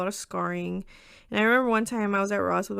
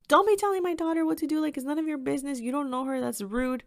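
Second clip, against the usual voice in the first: fast, higher mock telling-off; retold protective scolding, rattled off quickly; protective Mama Bear; 6 semitones higher than usual. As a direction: imitate a mom rapidly defending her daughter. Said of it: "my mom came in like Mama Bear".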